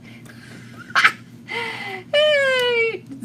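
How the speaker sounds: long high real laugh falling away; real laughter trailing down; big laugh. Real, not acted. Laughs at viewer's comment.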